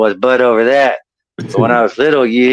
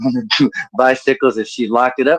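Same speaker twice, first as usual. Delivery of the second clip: ordinary talk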